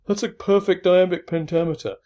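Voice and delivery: standing-up announcement. Retold or imitated. Lecturer imitating a friend wanting to stand up in a cafeteria, proclaiming. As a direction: call it out like an imagined cafeteria outburst. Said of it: "he wanted to stand up and say".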